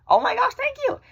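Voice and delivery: high squealing overjoyed thanks; thrilled thanks. Retold or imitated. Vlogger acting her relief at being off meds, squealing. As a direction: squeal it with delight. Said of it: "I was just like".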